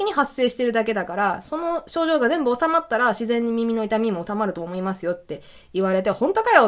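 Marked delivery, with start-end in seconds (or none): 6.1-6.7 much higher, skeptical scoff; doubtful. Retold or imitated. Doubting the doctor's advice, a skeptical scoff. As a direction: say it with disbelief. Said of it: "って思ったんですけど".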